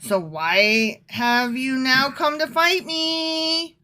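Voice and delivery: slow, drawn-out mock exasperation; exasperated. Jephthah questioning his attackers, exasperated and drawn out. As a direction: drag it out, hammed-up and fed up. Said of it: "give him that tone of voice".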